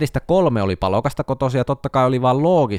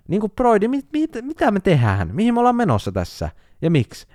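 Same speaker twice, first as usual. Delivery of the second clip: exasperated disbelief; 10 semitones higher than usual. Real, not acted. Exasperated rant at reader.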